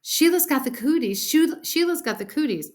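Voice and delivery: high, loud playground chant; boys' jeering singsong; hollered taunt. A narrator's reading. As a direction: holler it back as a tease. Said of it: "We hollered really loud".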